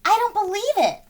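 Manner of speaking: high, stunned Luke; young hero can't believe it; Luke's disbelief. A narrator's reading. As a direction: gasp it out in disbelief. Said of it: "says Luke".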